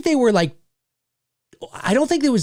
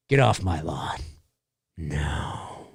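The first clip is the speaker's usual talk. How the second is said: very low, hushed growly warning; low mock menace; 7 dB quieter, much slower than usual. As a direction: drop right down and draw out the refusal. Mock menace toward high school kid.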